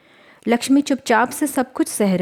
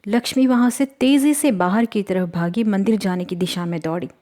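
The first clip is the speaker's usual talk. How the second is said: ordinary delivery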